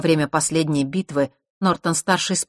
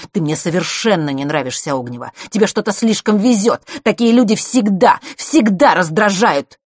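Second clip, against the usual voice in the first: anger building to loud, raised voice; furious dislike; 5 semitones higher, 7 dB louder than usual. A narrator's reading. Mark tells Ognева he dislikes her, furiously. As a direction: build to an exasperated outburst. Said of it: "Его глаза вспыхнули бешенством".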